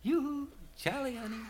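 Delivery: high, sweet giggly mermaid call; pretty little voice. A narrator's reading. Mermaid teases Charlie again, high sweet giggly coo. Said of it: "the same little voice says".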